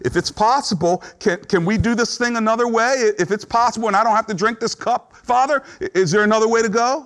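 Jesus pleading, pitched high and hesitant; bargaining plea. Acted. Preacher voicing Jesus asking the Father for another way, pleading. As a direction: ask for a way out, voice lifted. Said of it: "we see bargaining with Jesus".

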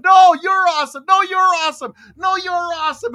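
high, loud gushing insistence; gushing dental assistant. Retold or imitated. Dental assistant insisting the dentist is awesome, high and loud. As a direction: pile on the praise like an excited assistant. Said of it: "the little dental assistant's there is going".

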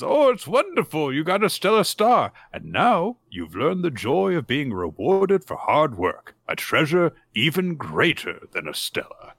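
high, sing-song grandiose voice; lofty old neighbor. Retold or imitated. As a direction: deliver it like a pompous sage.

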